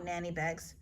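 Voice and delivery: plain reading voice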